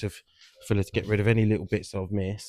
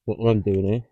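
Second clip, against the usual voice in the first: muffled behind a respirator mask; talking through mask; 3 semitones higher, 7 dB louder than usual. Real, not acted. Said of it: "Let me take my mask off. You can hear me a bit better".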